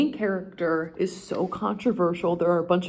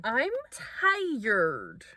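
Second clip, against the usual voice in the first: usual speaking voice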